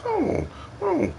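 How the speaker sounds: squeaky high "oh, oh"; high mock alarm. Man startled at snake shed while walking the dog, high yelp. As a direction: yelp like something spooked you.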